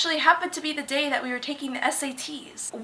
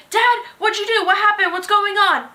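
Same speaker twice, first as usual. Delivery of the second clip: higher, urgent questions; anxious girl; 8 semitones higher, 4 dB louder than usual. Retold or imitated. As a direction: press her dad anxiously. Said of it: "It's like".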